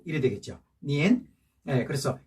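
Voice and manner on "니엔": "니엔" starting low and rising; second tone. Shown as a demonstration. Teacher giving the correct second tone, "니엔" rising. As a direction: lift the pitch through "니엔". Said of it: "이 성 구어 하니까".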